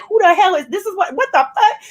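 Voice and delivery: high, baffled disbelief; mock outrage at what she hears; incredulous. Rapper scoffing at the state of hip hop, incredulous. Retelling reaction to rap stars.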